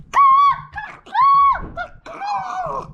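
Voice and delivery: very high, loud shrill caws; crow cawing. Acted. The birds cawing as they spot it, very high and loud. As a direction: squawk like a crow.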